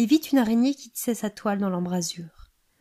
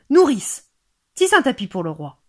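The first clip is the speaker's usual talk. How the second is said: loud, imperious order to a servant; commanding; 3 semitones higher, 7 dB louder than usual. A narrator's reading. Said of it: "Elles commandèrent". (ordre lancé d'une voix forte)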